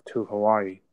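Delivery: ordinary talk, nothing marked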